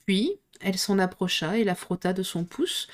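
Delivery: usual speaking voice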